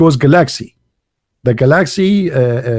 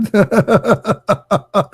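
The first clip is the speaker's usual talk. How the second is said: high, staccato burst of laughter; incredulous laugh; 7 semitones higher than usual. Real, not acted. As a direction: laugh helplessly at the absurdity. Laughs in disbelief at the claim.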